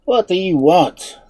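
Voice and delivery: loud, slow demand; stern, gruff. A narrator's reading. Unseen man challenging strangers, stern and gruff. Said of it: "in a stern, gruff accent".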